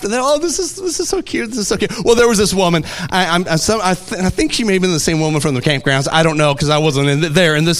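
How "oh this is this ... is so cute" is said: high, lightly voiced admirers; cooing onlookers. Diners reacting to a child's prayer, mildly cooing. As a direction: coo over a cute child, pitched up.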